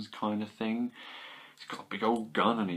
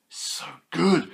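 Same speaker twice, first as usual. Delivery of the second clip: excited; 7 semitones higher, 6 dB louder than usual. Real, not acted. Excited repeat of praise.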